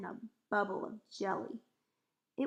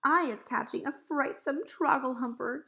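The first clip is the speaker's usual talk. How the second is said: raised, louder voice; distressed cry; 4 semitones higher, 6 dB louder than usual. A narrator's reading. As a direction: cry out the awful news. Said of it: "he cried".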